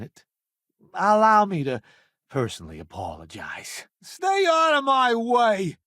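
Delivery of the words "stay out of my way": high, slow shouted warning; threatening battle shout. Acted. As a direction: shove the enemy off with your voice. Combat shout at enemies.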